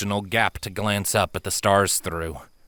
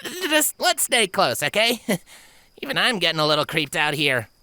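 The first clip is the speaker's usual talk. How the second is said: uneasy tomboy filly; 9 semitones higher than usual. Acted. Pegasus admits she's creeped out.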